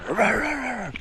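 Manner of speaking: high, loud mock dog bark. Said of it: "the dog just started going".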